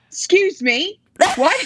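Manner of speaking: high-pitched mock British accent; attempted British accent. Singer's backseat question to driver, attempted British accent. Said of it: "I think that's British".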